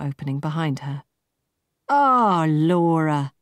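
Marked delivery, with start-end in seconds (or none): 1.9-3.3 cried out. A narrator's reading. The MD calls out to Laura entering, loud and delighted. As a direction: greet her across the room with a cry. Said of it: "cried the M D".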